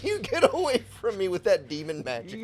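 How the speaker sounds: high, loud mock disgust; mock horror. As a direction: recoil from it like cursed magic. Joking rejection of house rule.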